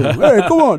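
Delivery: taxi driver. Retold or imitated. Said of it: "I want my taxi cab to sound like that too".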